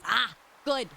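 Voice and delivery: ordinary talk, nothing marked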